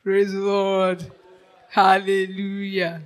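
ordinary talk, nothing marked

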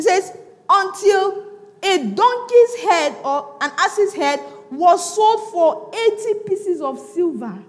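high, loud, very slow scripture reading; preach at full force. Real, not acted. Preacher reads famine prices to church, slow loud declamation. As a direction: stretch each word of the verse out loud.

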